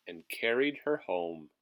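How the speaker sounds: ordinary delivery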